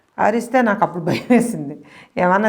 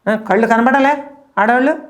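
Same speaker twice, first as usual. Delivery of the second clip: retold loud call; 5 semitones higher, 4 dB louder than usual. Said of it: "అని అన్నా".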